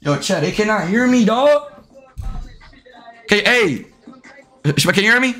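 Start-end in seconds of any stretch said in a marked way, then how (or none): none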